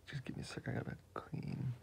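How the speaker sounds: soft, breathy mumbling while drawing; quiet murmur. Real, not acted. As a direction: murmur to yourself, half whispered. Whispering while he draws.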